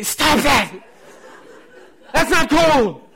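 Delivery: high, loud mock yell; embarrassed kids' protest. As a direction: yell like kids mortified by their parent. Voicing his embarrassed kids protesting.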